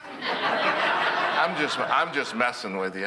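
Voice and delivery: laughing through the words. Real, not acted. Trooper owning up to the driver that it was a joke, laughing. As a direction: let the laugh break in as you confess the joke.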